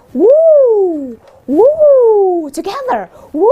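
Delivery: high loud whoop; excited cheer. Acted. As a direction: cheer it out big. Cheering along with listeners.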